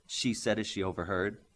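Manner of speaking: plain reading voice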